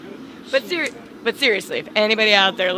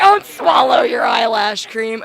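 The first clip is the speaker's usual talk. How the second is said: mock shout; 8 dB louder, slower than usual. Joking warning.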